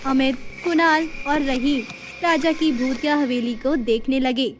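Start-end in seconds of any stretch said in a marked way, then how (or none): none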